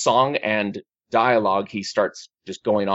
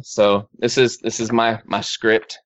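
ordinary talk, nothing marked

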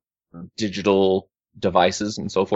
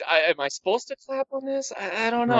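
high, hesitant, unsure little voice; confused audience member; 13 semitones higher, 4 dB quieter than usual. Retold or imitated. Imitating a baffled audience member who doesn't know when to clap, high and unsure. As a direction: play the puzzled theatregoer wondering whether to clap.

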